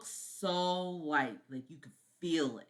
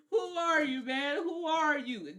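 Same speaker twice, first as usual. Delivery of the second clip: higher, louder indignant jealous lover; hammed-up indignant rival; mock outraged Jonathan; 12 semitones higher, 6 dB louder, slower than usual. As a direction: play the fiancé yelling at the vampire who took his girl.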